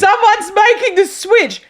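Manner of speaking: high, showy mock announcement; enthusiastic boom. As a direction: imitate an overexcited salesman telling the whole shop. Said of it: "In the most enthusiastic, loud boom".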